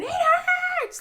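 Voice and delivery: put-on high, loud child screech; screeching kid. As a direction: shriek it like a hurting boy. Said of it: "he's screeching, going".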